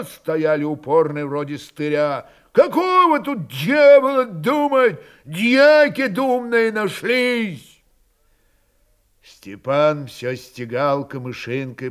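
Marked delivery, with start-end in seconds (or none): 2.5-7.8 high, loud, scornful yell; angry shouting. A narrator's reading. Stubborn Cossacks scoff at thinking it over, angry shouting. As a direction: jeer at all this pointless pondering. Stubborn men yelling against deliberation.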